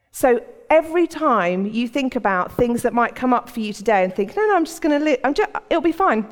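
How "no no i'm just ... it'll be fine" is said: higher, hasty brush-off; lightly acted, wave the worry away; mock dismissive. Procrastinator putting off a problem, dismissive.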